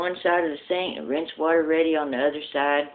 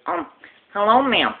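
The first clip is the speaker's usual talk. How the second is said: lisping salesman; 6 semitones higher, 4 dB louder than usual. Acted. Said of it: "he talks with a lisp".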